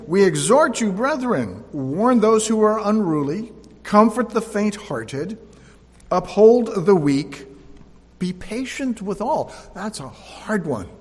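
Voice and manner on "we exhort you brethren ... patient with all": loud, high preacher's reading, slowing on the last phrases; preaching scripture. Acted. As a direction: proclaim the verses to the congregation. Reads scripture at full preaching force.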